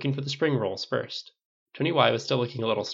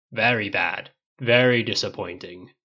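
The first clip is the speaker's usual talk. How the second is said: put-on grim, loud, slightly deepened old-man voice; imitating grandpa; 6 dB louder, slower than usual. A narrator's reading. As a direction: pronounce stern judgement as grandpa, slow and heavy. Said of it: "imitated in a deep voice".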